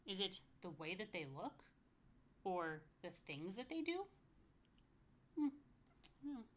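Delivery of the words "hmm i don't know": usual speaking voice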